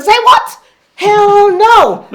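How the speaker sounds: very high, loud incredulous refusal; indignant inner voice. Retold or imitated. Inner self rejecting the doctor's pills, loudly incredulous. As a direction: refuse it with comic outrage. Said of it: "that one inside of me said".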